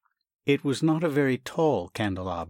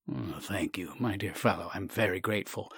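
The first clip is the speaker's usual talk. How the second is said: softer, resigned gratitude; subdued thanks; 2 semitones lower, 6 dB quieter than usual. Acted. Doctor yielding to Sasha, soft resigned thanks. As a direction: give in quietly.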